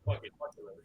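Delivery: whispered, half laughing; mock whisper. Friend replaying a movie line to a podcast host, whispered. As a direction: breathe it like a dare.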